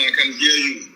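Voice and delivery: ordinary talk, nothing marked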